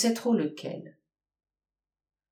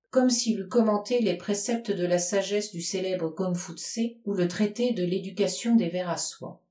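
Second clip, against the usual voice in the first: ordinary delivery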